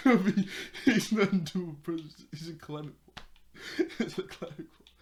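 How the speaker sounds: helpless laughter breaking up the words; real laughing. Real, not acted. Cracking up over a climbing wall, laughing. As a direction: talk through a laughing fit.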